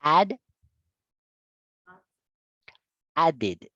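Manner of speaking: "Add" then "Added" with the "-ed" as a full second syllable; teacher drills present then past; English model words. Shown as a demonstration. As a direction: contrast "Add" and "Added" clearly. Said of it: "presente, pasado".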